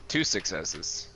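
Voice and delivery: ordinary talk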